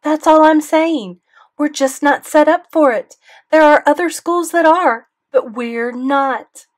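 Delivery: loud, high angry tirade; mother ranting at the school board, loud fury; furious rant. A narrator's reading. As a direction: tear into the principal. Mom continues tirade.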